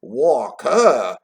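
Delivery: loud, stretched, swooping "Walk her"; surprised exclamation. A narrator's reading. Boy exclaiming at Scrooge, surprised. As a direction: exclaim it with a big rise and fall. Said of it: "exclaimed the boy".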